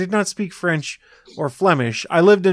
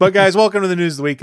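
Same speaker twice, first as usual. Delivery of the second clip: quick, louder show-host welcome; host intro; 6 semitones higher, 4 dB louder, faster than usual. Acted. Host welcomes listeners to the news segment, brisk. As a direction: kick off the segment like a presenter. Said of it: "a little segment we like to call".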